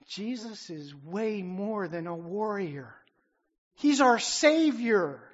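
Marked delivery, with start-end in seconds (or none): 3.7-5.2 booming pulpit declaration; full-force preaching. Real, not acted. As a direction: proclaim it to the back row. Preaching the King of Kings.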